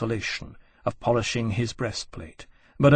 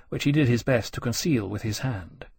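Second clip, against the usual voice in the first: usual speaking voice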